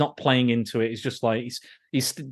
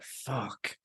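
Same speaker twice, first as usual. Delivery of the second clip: muttered fuck; 14 dB quieter than usual. Retold or imitated. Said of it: "Statham's like".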